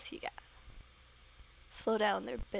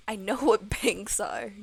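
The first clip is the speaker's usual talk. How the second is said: much louder, indignant retort; acted exasperated comeback; loud protest; 11 dB louder than usual. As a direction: protest loudly at a silly question. Character protests being quizzed.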